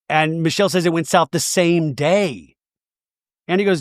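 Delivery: normal talking voice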